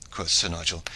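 usual speaking voice

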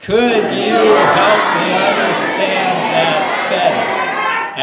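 slow, loud group yell; big crowd yell, laughing. Shown as a demonstration. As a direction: belt it out slowly with the crowd. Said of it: "if you want to yell it this time".

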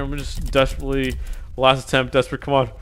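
ordinary talk, nothing marked

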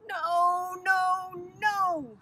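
high, loud, drawn-out refusal; crying out. A narrator's reading. Sister rejects the named cities, crying out. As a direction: cry out each no, long and insistent. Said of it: "she cried".

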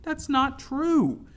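very high, slow, loud protest; drawn-out high wail of denial; anguished protest. A narrator's reading. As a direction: cry out in wounded denial. Tearful girl protests Fisk's accusation.

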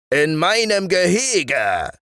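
high, slow indignant roar; giant bellowing. A narrator's reading. Giant claims his valley from the shepherd, slow roar. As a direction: drag out the outrage like a giant. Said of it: "brüllte der Riese".

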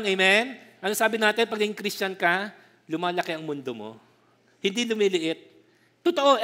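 ordinary talk

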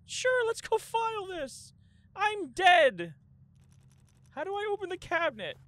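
high, loud outraged voice; rattled, yelling at the game; panicked indignant shouting. Real, not acted.

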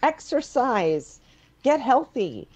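high, loud and slow, pushing hard; emphatic urging. Acted. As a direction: push each idea at him. Daughter urging father to retire.

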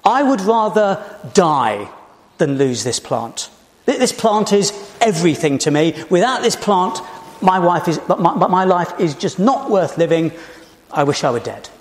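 hammed-up tantrum, toys out of the pram; melodramatic whining. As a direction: overplay the despair for comic effect. Jonah's melodramatic lament acted out.

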